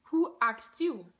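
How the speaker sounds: slow, drawn-out mock indignation; indignant retort. Wig owner challenging a nosy bystander, slow indignant retort. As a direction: stretch each word into a pointed comeback. Retold retort repeated with emphasis.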